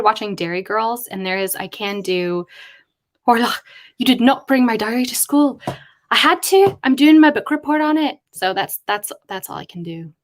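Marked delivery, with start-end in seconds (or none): 3.3-5.6 put-on Irish accent. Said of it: "I can't do an Irish accent". 6.1-8.2 put-on Irish accent. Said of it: "I can't do an Irish accent".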